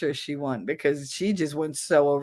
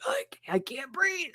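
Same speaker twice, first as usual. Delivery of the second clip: put-on softer, stammering breathless kid; a hint of a kid gasping for air; mild mock panic; 7 semitones higher, 7 dB quieter than usual.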